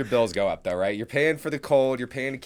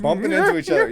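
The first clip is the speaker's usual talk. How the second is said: laughing; 8 dB louder, faster than usual. Real, not acted. Laughing high exclamation.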